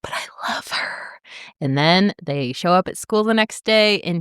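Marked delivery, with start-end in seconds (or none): 0.0-1.2 slow breathy whisper; mock lovesick whisper; pleading whisper. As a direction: whisper it slowly, heartbroken. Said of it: "And James is like".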